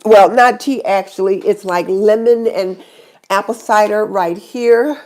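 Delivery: ordinary delivery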